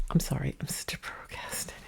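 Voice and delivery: a quick whispered apology to herself; whispered aside. Real, not acted. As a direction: murmur it off to the side, half embarrassed. Aside while changing the light.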